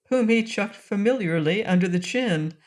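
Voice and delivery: usual speaking voice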